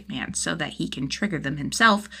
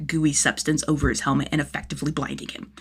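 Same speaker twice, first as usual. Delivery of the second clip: usual speaking voice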